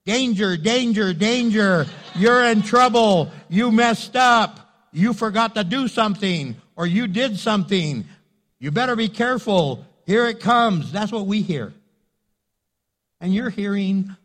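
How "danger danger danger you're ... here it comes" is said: loud alarm-like warnings, slowing on trouble. Retold or imitated. What husbands hear from wives, loud mock alarm. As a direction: blare it like an alarm going off. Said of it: "let me tell you what we hear".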